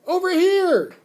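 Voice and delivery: full high, very loud yell; piercingly high desperate holler; scream. A narrator's reading. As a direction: scream for attention from across the room. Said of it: "I screamed".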